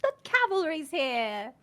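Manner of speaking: put-on hammy Cockney accent; British accent. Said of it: "Oh, kill me on that one".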